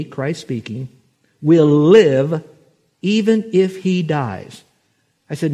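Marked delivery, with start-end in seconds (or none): 1.4-4.6 slow, loud and higher, leaning on live and even; said slowly. Shown as a demonstration. Preacher reading the verse slowly to mourners, drawn out. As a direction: draw out every word, pressing them home. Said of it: "Let me say that slowly".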